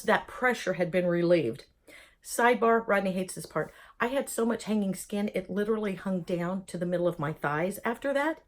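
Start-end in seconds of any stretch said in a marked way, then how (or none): none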